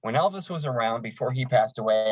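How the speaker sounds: ordinary delivery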